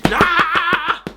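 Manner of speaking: high, drawn-out battle yell; testosterone yell. Acted. The movie fills you with testosterone, yelling. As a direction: let out a pumped-up roar.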